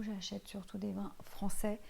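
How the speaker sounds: ordinary talk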